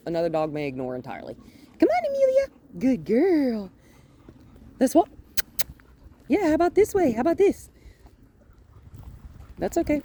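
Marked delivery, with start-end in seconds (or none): 1.8-10.0 very high, loud squeaky praise voice; lavish praise. Real, not acted. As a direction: coax her along in a bright dog voice. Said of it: "really lavishly praise her".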